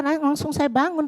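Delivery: usual speaking voice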